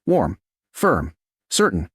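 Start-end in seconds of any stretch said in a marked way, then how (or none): none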